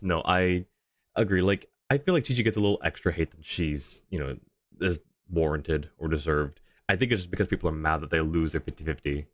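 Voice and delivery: ordinary talk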